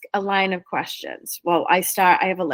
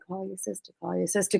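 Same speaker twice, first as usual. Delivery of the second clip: soft nudging voice; 11 dB quieter, faster than usual. Retold or imitated. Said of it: "Spirit will keep saying".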